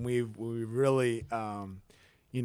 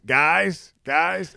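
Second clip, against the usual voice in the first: louder, higher call for attention; mock post-game "guys"; imitating coach's speech; 5 semitones higher, 7 dB louder than usual. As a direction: lightly mimic a coach addressing the team. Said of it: "it's post-game speech".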